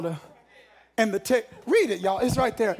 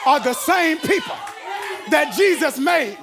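raised, emphatic sermon delivery; fired-up preaching; 6 semitones higher than usual. Acted. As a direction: drive each phrase home to the crowd. Preaching at full force.

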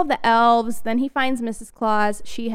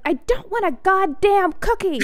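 high, exasperated mock snap; fed-up outburst; 10 semitones higher than usual. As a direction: blurt it out like a cranky elf who's had enough. Elf finally snaps at everyone.

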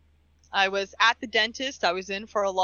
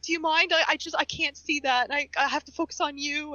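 higher-pitched retold plea; imitated dental assistant; 6 semitones higher than usual. Dental assistant asks patient to turn off movie, higher imitated plea. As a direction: lightly voice an apologetic assistant, pitched up. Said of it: "she's like".

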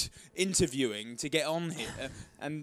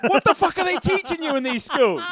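much louder and higher, disbelieving rant; exasperated outburst; 13 semitones higher, 11 dB louder than usual. Real, not acted. Co-host venting at an ignorant pop star, loud exasperated outburst. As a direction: let the outrage burst out loud. Ranting about [name] interview.

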